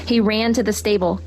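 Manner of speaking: usual speaking voice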